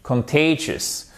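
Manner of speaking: slow "contagious" with weight on TAY; "contagious" as kun-TAY-jus, stretched; stressed middle syllable. Shown as a demonstration. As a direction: lean on the TAY of "contagious", let the end fall. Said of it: "les places des accents toniques".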